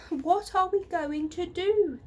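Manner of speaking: loud, high panicked yell; frightened children yelling. A narrator's reading. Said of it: "they yelled".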